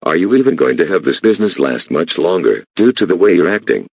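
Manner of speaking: loud, heated accusations; angry yelling. Acted. Employee yelling at the boss about being fired, loud. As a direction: raise your voice and accuse.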